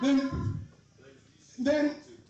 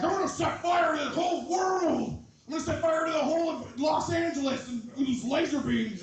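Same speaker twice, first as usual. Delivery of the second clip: devil; 6 semitones higher than usual. Acted. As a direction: proclaim it like a hammed-up devil. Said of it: "the devil's like".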